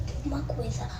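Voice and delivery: soft unvoiced whisper; whispering while hiding. Real, not acted. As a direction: breathe the words out low.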